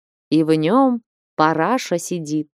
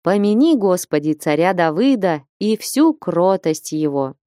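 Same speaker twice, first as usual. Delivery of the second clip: ordinary delivery, nothing marked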